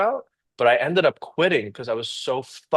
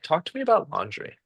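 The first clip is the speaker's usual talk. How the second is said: coaxing interviewer; 6 semitones higher, 3 dB quieter than usual. Retold or imitated. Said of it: "I'm like".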